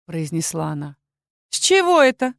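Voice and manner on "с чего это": high, smirking challenge; haughty, high-pitched scoff. A narrator's reading. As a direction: toss it back with a sneer. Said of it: "усмехнулась Ельсия".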